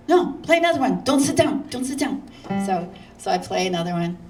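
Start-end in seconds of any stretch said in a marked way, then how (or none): 0.1-2.2 insistent Milton. Retold or imitated. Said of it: "he goes".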